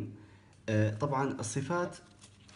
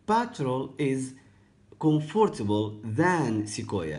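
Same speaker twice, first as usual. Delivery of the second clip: slow model answer; 2 semitones higher, much slower than usual. Shown as a demonstration. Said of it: "teacher models the answer".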